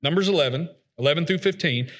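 ordinary talk

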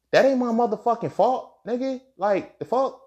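real exasperation bursting out; indignant outburst. Real, not acted. Speaker venting that the fault is not his. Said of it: "outburst about apologizing".

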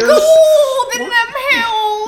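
high, slow laughing prospector; old prospector. Acted. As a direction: cackle it like an old miner. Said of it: "sound like a prospector".

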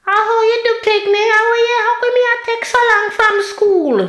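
put-on high, sweet Jamaican mother sweet-talking the kids; mock-sweet Jamaican mom calling her kids in; kind Jamaican voice. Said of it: "she'd put on her kind Jamaican voice".

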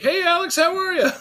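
high, loud put-on hello; mock enthusiastic greeting. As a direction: hail an acquaintance in a high, exaggerated voice. Acts out people greeting them by name.

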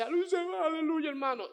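high, fervent cry of praise; ecstatic aleluya. Retold or imitated. Man shouts praise in service.

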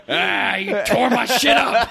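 high, slow, laughing outcry; hammed-up high cry of pain; mock agonised wail. Partner blaming an overly big lover, mock agony. Acted complaint of a hurt partner.

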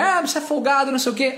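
high-pitched retold complaint; mock arguing. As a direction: bicker as the woman, imitated. Imitates woman arguing with driver. (imitando mulher discutindo)

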